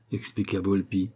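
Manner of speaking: usual speaking voice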